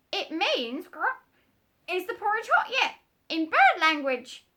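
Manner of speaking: very high, loud parrot squawk. Acted. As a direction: screech like a bossy parrot.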